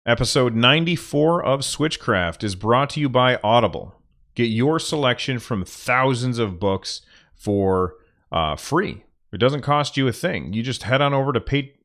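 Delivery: upbeat podcast ad read; sponsor read. Acted. Podcaster reading the Audible sponsor spot, upbeat. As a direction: sell it like a host reading an ad. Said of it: "now I'm gonna do the sponsor read".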